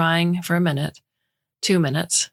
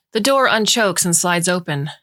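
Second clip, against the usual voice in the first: ordinary delivery, nothing marked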